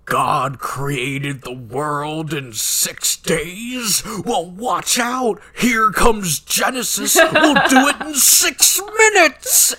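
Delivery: builds from slow to a high laughing shout; mock ranting yell. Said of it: "he straight up says".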